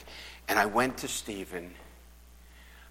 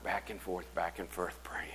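fading repetition; 3 semitones lower, 9 dB quieter than usual. Retold or imitated. Pastor recalls pacing the altar.

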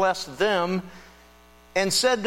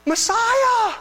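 high, loud cry of Messiah; joyful cry; 6 dB louder than usual. Retold or imitated. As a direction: burst out with the word. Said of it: "breaking out in this charge".

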